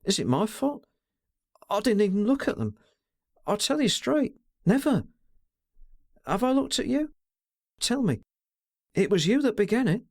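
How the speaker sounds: lightly voiced girl, anxious and protesting; pleading girl. A narrator's reading. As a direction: plead her innocence, rising in distress. Girl's voice pleading.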